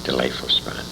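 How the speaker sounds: soft, breathy near-whisper; hushed aside. Real, not acted. Old man whispering an aside about the Canadian cottage.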